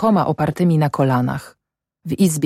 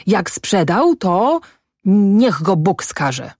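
slow, higher-pitched exclamation; called out; 5 semitones higher, much slower than usual. A narrator's reading. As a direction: declare it as a curse, drawing it out. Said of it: "zawołała".

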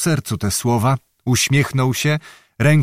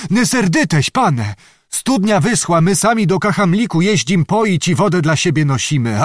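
higher, pleading herdsman voice; humble herdsman; 6 semitones higher than usual. A narrator's reading. Cowed herdsman apologising to the angry noble, pleading. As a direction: beg the lord's pardon meekly.

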